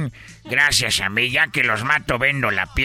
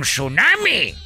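raised, high crowd alarm; mock panic cry; 8 semitones higher, slower than usual. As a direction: cry out like a fleeing crowd, mildly hammed up. Crowd panics in retold joke.

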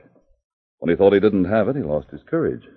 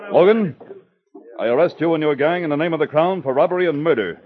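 commanding arrest; 8 semitones higher than usual. Acted. Sergeant arrests the gang.

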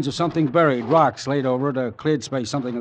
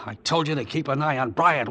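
normal talking voice

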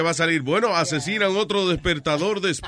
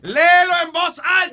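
high, impatient shout; shouted command to read; exasperated yell; 11 semitones higher, 4 dB louder than usual. Real, not acted. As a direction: order him at the top of your pitch. Third request, patience gone.

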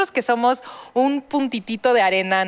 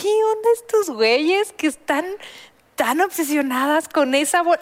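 high, strange voice of a puzzled alien; mock an alien baffled by humans; 5 semitones higher than usual. As an alien watching people obsess over a bag, high and strange. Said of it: "Imagínate a alguien de otra galaxia".

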